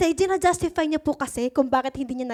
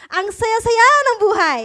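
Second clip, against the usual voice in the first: joyful; 6 semitones higher, 6 dB louder than usual. Real, not acted. Joyful ending of the servants.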